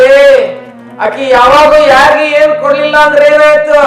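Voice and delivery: roti pleading with lightning. Acted. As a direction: stand in the way and beg the lightning loudly. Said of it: "ಅಡ್ಡ ನಿಂತ ಹೇಳ್ತು".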